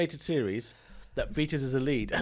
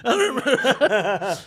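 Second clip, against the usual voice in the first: loud, high and slow through laughter; real laughing outburst; 8 semitones higher, 8 dB louder, slower than usual. Real, not acted. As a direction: burst out laughing on the words. Cracking up at forgotten joke.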